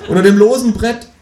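high, loud kangaroo voice; high-pitched, gleeful talking kangaroo; cheerful kangaroo. A narrator's reading. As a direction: chirp it triumphantly as the kangaroo. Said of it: "sagt das Känguru fröhlich".

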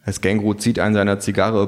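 usual speaking voice

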